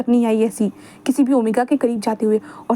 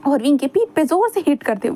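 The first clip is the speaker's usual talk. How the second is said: ordinary delivery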